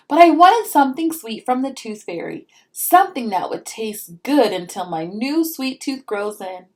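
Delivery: lively storybook read-aloud; reading to children. A narrator's reading. Mom reading a picture book to her child, storybook voice.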